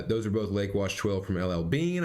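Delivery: ordinary delivery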